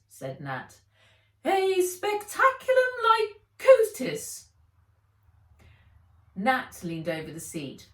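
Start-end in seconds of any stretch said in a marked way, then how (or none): 1.4-4.5 much higher, louder proud naming; Fred's voice. A narrator's reading. Fred telling Nat the plant's name, high and loud. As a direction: announce the name grandly.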